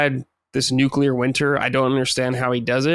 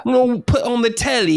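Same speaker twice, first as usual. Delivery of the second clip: high, slow put-on English; mock British accent; 10 semitones higher than usual. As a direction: play a silly Brit about the telly. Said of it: "but what do they say?".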